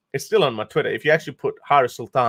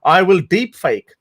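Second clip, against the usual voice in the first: mock troll threat, louder; 6 semitones higher, 5 dB louder, slower than usual. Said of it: "This is allegedly I'm doing it".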